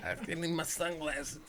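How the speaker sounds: very quiet, high, choked words; strained after dab. Real, not acted. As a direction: squeeze it out before the next cough. Choking after a hot dab.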